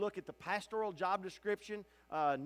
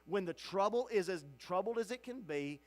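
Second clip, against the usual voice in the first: usual speaking voice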